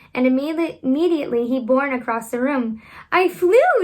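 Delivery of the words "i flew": high, loud joyful scream; excited scream. A narrator's reading. Michael yelling as he first flies, thrilled scream. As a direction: shout it out in pure delight from midair. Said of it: "he screamed".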